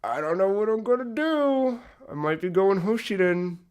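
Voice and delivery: a light mock-anxious wail; mock dithering. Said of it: "made me go".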